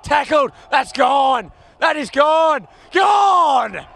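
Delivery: thrilled play-by-play yell; excited call. Real, not acted. Commentator calls a big tackle.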